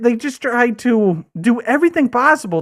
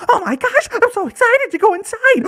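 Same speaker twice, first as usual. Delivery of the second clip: much higher, gushing eager voice; giddy fake excitement; 13 semitones higher than usual. Actor mocking his own eager arrival at work, gushing. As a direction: gush like a thrilled kid at Disney. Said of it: "I was just like".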